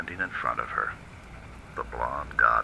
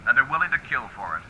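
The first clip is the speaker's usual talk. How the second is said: higher, wry tough-guy cop voice warning a dame; hard-boiled detective; 8 semitones higher than usual. Acted. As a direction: lean in like a cynical gumshoe, then drop to a quick mutter. Detective warns Sylvia.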